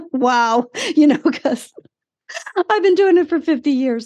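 laughing. Real, not acted. Laughing while retelling therapist's reaction.

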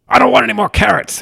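high, loud irritated outburst; hammed-up domestic tantrum; snapping. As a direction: act out a stressed-out snap. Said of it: "I've snapped at my wife before".

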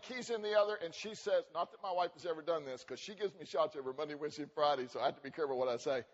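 ordinary delivery

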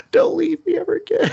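very high, hammed-up crying plea; mock tearful plea. Clingy hero begging her after she returns, mock tearful. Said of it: "he cries a lot".

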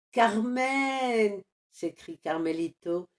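usual speaking voice

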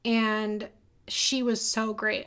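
ordinary talk

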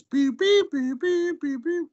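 high squeaky beep beep, like a chat alert; imitated Skype beeps. Friend's Skype messages chiming, high imitated beeps. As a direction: chirp the message tones up high. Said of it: "the Skype messages".

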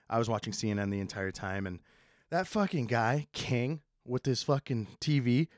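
ordinary talk, nothing marked

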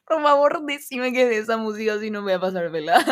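fast and laughing. Real, not acted. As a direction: crack up mid-sentence. Laughing about the song.

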